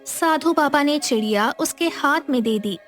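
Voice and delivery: ordinary delivery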